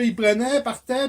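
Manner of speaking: usual speaking voice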